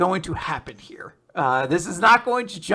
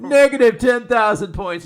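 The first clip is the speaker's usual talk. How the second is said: louder, higher mock scorekeeper call; smug game announcer; 7 semitones higher, 10 dB louder than usual. Nobles scoring the hunt, a mock announcer call.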